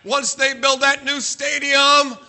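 high, loud hammed-up fan prophecy; mock rallying cry. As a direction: proclaim it like a mock rally, stretched and building to a shout. Mock rally for his football team.

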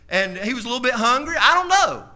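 preacher swelling to a loud shout; rising shout. Real, not acted. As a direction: build up and belt it.

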